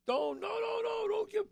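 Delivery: a sudden high-pitched protest to stop him; bouncers crying out no. Retold or imitated. Bouncers begging him not to give money, high-pitched protest. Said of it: "the guys at the bouncers are going".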